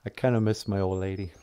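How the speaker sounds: ordinary talk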